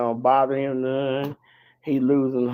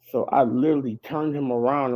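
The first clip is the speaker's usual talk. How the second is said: ordinary talk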